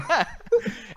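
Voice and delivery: short high breathy laugh; high giggle. Real, not acted. Laughing after the gun joke.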